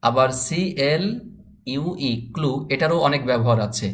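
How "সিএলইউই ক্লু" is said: drawn-out classroom spelling of সিএলইউই; teacher spelling a word slowly for learners. Shown as a demonstration. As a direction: spell সিএলইউই slowly letter by letter, then say clue. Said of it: "এই ক্লুটার spelling কেমন হবে".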